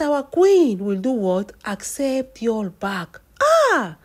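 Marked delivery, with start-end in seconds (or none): 3.4-4.0 sharp high shocked cry of ah; loud startled exclamation. Real, not acted. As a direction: gasp it out, stunned by the news. Shocked reaction to the news.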